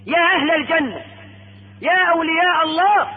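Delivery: high-pitched herald's call. Acted. Caller hailing the people of Paradise, calling out. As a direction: call out with long vowels. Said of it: "يسمعون المنادي".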